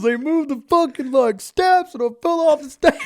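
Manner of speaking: very high, loud mock wail; hammed-up wailing excuse. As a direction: squeal it out, breaking into laughter. Retelling friend's excuse to laughs.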